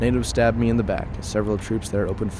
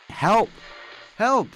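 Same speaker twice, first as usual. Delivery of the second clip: desperate cry for help; 13 semitones higher than usual. Acted. Wounded soldier alone among dead comrades, desperate high shouts for help. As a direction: yell for help into the silence.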